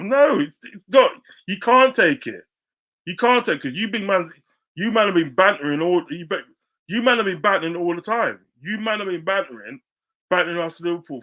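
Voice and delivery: loud, high heated shouting at rival fans; angry shouting. Real, not acted. Shouting at Spurs fans who can't take banter, angry and loud. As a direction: yell it, fed up with their hypocrisy.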